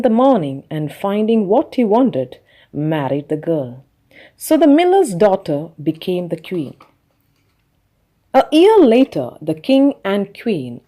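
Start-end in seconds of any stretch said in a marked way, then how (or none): none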